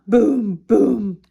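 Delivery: loud imitated drum beats; drum booms. A narrator's reading. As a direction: boom it out like a big drum. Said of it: "Bean plays his drum".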